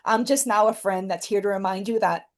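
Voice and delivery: ordinary talk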